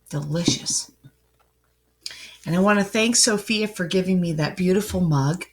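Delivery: ordinary delivery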